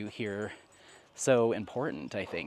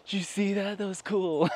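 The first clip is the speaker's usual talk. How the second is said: higher, mock-awed onlookers; impressed onlookers; 6 semitones higher than usual. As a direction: play the crowd gasping at the dog. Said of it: "staring at us. Like".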